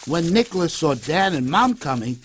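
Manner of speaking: plain reading voice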